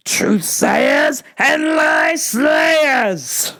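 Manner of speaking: slow, high, drawn-out shout; hammed-up battle cry. Host rallying listeners at sign-off, hammed-up shout. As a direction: roar the slogan like a rallying cry.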